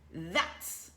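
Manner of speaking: slow, lightly voiced gruff chief; Stoick's voice. A narrator's reading. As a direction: say it like a proud chief. Stoick's smug voice.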